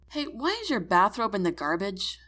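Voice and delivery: ordinary delivery, nothing marked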